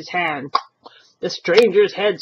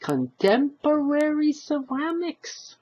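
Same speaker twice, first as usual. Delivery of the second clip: relishing syllables; 7 semitones higher than usual. Acted. Old husband listing the boarder's classes, relishing. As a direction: roll every word on the tongue. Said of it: "relishing the syllables".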